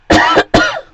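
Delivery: loud real cough; a hard cough, then carry on. Real, not acted. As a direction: cough breaking in from a cold. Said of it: "second time I've coughed now".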